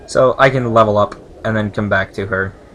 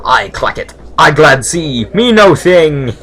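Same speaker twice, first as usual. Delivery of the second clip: loud broken-English servant; rough servile speech; 4 semitones higher, 11 dB louder, slower than usual. Acted. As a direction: play a bold, simple servant in halting words. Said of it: "This is the sort of speech".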